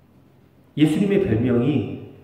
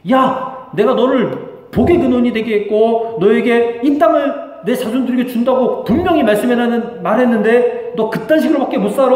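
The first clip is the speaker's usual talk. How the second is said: loud, raised-pitch rebuke; acted stern dressing-down; angry scolding; 8 semitones higher, 7 dB louder than usual. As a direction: scold them sharply, voice pushed high. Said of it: "하고 막 꾸짖었어요".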